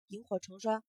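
usual speaking voice

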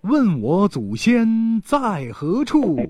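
slow, drawn-out proverb recital; slow recitation. Shown as a demonstration. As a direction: recite it slowly like a saying. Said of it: "人家是这么说的". (缓慢吟诵)

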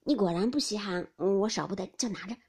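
ordinary delivery, nothing marked

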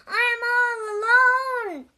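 high, loud wail of alarm; long, high panicked cry; screamed. A narrator's reading. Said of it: "screamed Toad".

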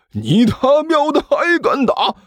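very high, drawn-out outrage; escalating fury. A narrator's reading. Zhang Yefei is slapped yet again, escalating fury. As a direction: squawk it slowly in indignant rage. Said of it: "顿时更怒了".

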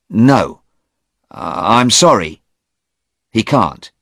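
high, loud startled question; startled young man; surprised young man. Acted. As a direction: woken too early and baffled. Stephen voiced.

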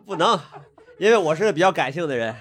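mock the serious guest while laughing; playful self-deprecating laugh. Real, not acted. Teasing self-mockery, laughing throughout.

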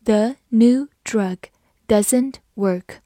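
deliberate, spaced-out teacher reading; slow model reading. Shown as a demonstration. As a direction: read slowly word by word. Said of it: "我们慢慢来读".

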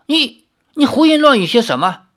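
又高又响的结巴怒喝; 心虚又恼羞成怒地喝止. A narrator's reading. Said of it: "喝道". (太守被揭丑后恼羞成怒地喝斥)

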